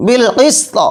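বিল কিস্ত with s held, then t released; teacher's careful stop on বিল কিস্ত; pausal consonant cluster. Shown as a demonstration. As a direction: hold the s of কিস্ত before a short final release. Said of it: "সাকিন হয়ে গেল".

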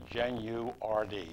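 Genu-R-D slowly, every syllable sounded; proper pronunciation. Shown as a demonstration. Guest teaching host his surname, Genu-R-D slow and complete. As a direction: say Genu-R-D as Gen-you-ar-dee, deliberately. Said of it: "You pronounce all syllables".

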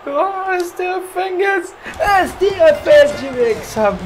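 very high, slow, drawn-out celebration; victory shout. Real, not acted. Gamer celebrating as the winner is announced. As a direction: cry out the winner's theme in triumph.

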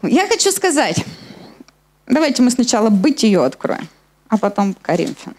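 normal talking voice

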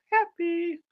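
very high, soft squeak; playful high little voice; happy wolf. Pretend. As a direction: squeak it happily.

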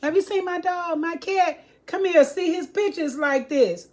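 high, sweet-talking stranger voice; stranger luring kids. Retold or imitated. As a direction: coax like a fake friendly man. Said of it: "And they be like".

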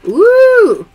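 high, loud, swooping ooh; big impressed whoop. Real, not acted. Host reacts to a viewer's heritage, impressed ooh.

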